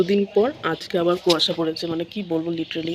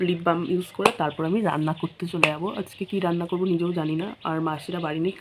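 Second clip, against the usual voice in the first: ordinary talk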